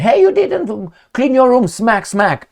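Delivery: high, loud mock-mother scolding; scolding mom. Mom scolding her son about his messy room, high and sharp. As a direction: play the nagging mom catching him out.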